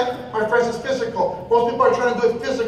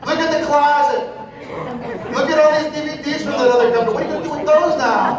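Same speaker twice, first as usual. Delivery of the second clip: scolding relative; 3 semitones higher than usual. Retold or imitated. Family member scolding about closet.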